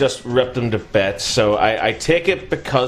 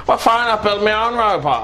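high, whiny Cockney put-on voice; mock Cockney; 9 semitones higher than usual. Younger brother stepping out of the shadows, mock Cockney. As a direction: put on a comic Cockney accent. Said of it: "I don't know why the Cockney Londoners".

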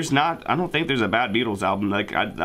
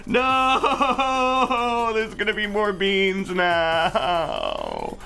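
high, wailing dismay; despairing character; 12 semitones higher than usual. Acted. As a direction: wail it out in despair.

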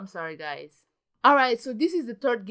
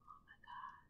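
whispered awe; 28 dB quieter than usual. Real, not acted. Tense moment.

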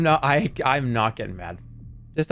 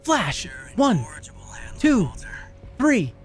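put-on higher, lively coaxing voice for a child; reassuring parent; 4 semitones higher than usual. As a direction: count along to calm a scared kid. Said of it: "reassuring a kid in a storm".

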